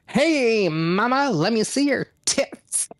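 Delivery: a cocky newborn hitting on mom. Pretend. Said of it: "going like".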